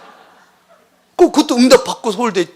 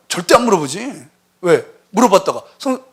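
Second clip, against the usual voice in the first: ordinary talk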